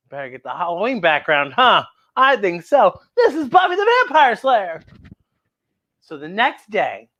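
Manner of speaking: usual speaking voice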